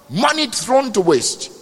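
normal talking voice

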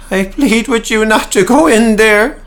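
nervous stonemason begging the slayers to stay out; frantic plea. Acted. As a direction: plead with them desperately. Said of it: "his pleas were to no avail".

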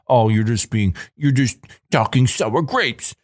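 high, sneering mock voice climbing on sour grapes; mocking supervisor. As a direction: mimic a smug boss brushing you off. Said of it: "the supervisors would say".

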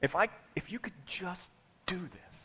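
hushed whisper. Real, not acted. Preacher whispers to congregation, hushed. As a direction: drop to a whisper to draw them in. Quiet aside.

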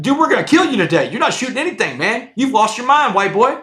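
high, loud mock threat; mock threatening inmates.

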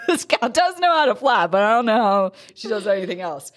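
laughing. Retold or imitated. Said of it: "They're like".

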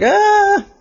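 sudden very high cartoon shriek; high startled shriek. The startled player shrieking at Elliot, high shriek. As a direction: shriek in fright.

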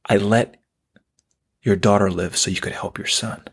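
low, calm voice from beyond; inner voice. Retold or imitated. A divine voice tells the shaken father, low calm. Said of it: "And then I hear".

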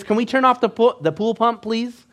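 lightly voiced little girl asking politely. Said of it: "she sits up and she says".

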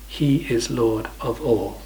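slow, deliberate "He is Lord of all"; very clear declaration. Retold or imitated. Preacher quoting Peter to the congregation, slow and very clear. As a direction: spell it out slowly, pausing between phrases. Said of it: "Peter declares very clearly".

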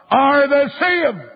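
high, drawn-out preacher's holler; retold shout. Evangelist shouting in a preacher's ear, imitated high shout. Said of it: "I shouted in his lug".

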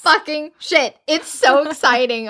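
high, slow excited exclaim. Real, not acted. Fan gushes to cohost about the twist, excited. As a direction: gush with delight. Gushing over plot twist.

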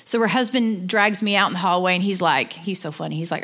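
usual speaking voice

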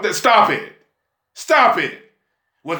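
normal talking voice

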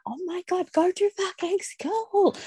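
high, slow, strained fed-up groan; exasperated inner rant. Retold or imitated. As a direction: let the frustration drag out. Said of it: "I was like".

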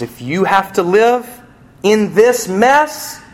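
lifted, slow and pleading; voice of God. Acted. Preacher voicing God speaking to suffering people. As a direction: lean in as God offering help.

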